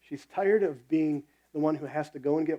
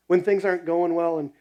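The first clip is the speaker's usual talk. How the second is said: ordinary talk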